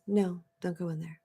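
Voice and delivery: the calm inner voice, softly; calm quiet warning. Shown as a demonstration. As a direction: say it quietly, without alarm. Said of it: "It was calm, it was quiet".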